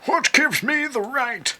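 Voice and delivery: high, loud, theatrical character voice; squeaky mock-outraged voice; silly put-on voice. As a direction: ham up an indignant aristocrat. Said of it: "doing voices all of a sudden".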